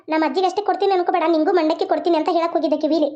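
normal talking voice

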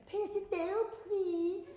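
mild, polite request with no energy; just hand it along, dutifully; generic polite vendor. Retold or imitated. Tired vendor asking fans to pass lemonade, politely flat. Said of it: "I was very generic".

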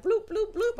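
high-pitched popping bloops; imitated bloop noise. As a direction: imitate cash being flicked out. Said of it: "He was like".

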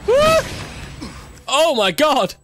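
high startled shriek; jump-scare yelp; startled scream. Real, not acted. Sudden game scare.

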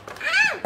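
short high squeal; sudden pretend shriek of delight; excited squeal. Shopper spots face paint, short excited squeal.

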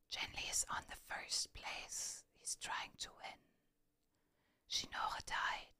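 hushed whisper, play-by-play; whispered commentary. Real, not acted. Streamer narrating who leads the marble race, whispering. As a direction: whisper the race news to the audience.

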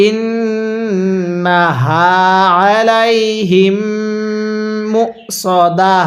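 ইন্নাহা slowly drawn out with nasal ghunnah on নুন; ইন্নাহা recited slowly with ghunnah on the নুন; correct tajweed. Shown as a demonstration. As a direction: hold the doubled নুন of ইন্নাহা, then a clean হা. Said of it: "তাহলে".

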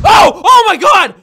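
loud high frightened yelp; startled yell. Real, not acted. Slapped from behind by a player, yelling in fright. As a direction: jump out of your skin. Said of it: "That scared me".

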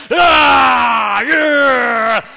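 loud, drawn-out conquering yells; hammed-up jungle-hero hollering; mock triumphant roar. Imitating the hunter twisting the knife, mock triumphant roar. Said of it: "he acted like Tarzan".

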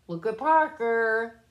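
usual speaking voice

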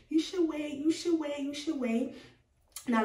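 ordinary delivery

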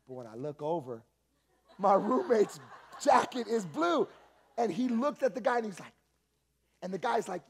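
ordinary talk, nothing marked

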